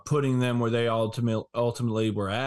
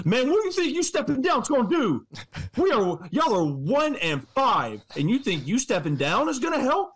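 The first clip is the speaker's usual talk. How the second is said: exasperated rant; 9 semitones higher than usual. Real, not acted. Ranting at the coach.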